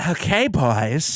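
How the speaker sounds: slow, lightly ladylike drawl; a hint of a sassy drag queen; lady voice. Shown as a demonstration. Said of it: "It's gotta be like a lady voice".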